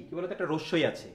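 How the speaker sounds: ordinary talk, nothing marked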